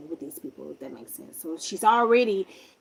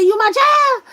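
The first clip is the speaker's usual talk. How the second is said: high, loud mock-incredulous yell; freaking out; 13 dB louder than usual. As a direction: imitate a man shrieking in disbelief. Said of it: "like, freaking out".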